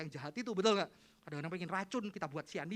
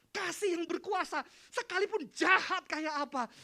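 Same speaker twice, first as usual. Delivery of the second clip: loud, high, slow proclamation; forceful preaching; 12 semitones higher, 13 dB louder, slower than usual. Acted. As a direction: preach it out slowly at full force. Preacher proclaims power of love.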